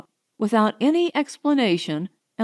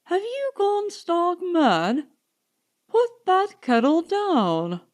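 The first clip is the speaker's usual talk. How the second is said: high, slow, incredulous rebuke; exasperated scolding; 9 semitones higher, slower than usual. Acted. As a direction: tell her off in disbelief. Scolding her frantic scheme.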